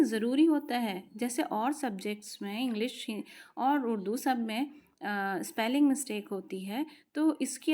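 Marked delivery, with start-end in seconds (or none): none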